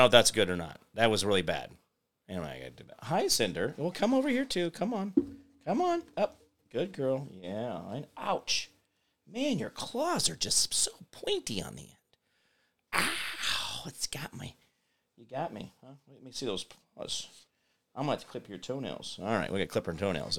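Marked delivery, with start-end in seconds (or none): 3.8-7.3 high, soft coaxing voice; coaxing pet voice. Real, not acted. 9.3-16.6 pained whispered "ow"; whispering. Real, not acted. Pet scratching him.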